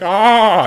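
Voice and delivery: loud, high fleeing yell; mock-terrified aah; frightened scream. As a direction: cry out while running away. Guy runs away from dragon.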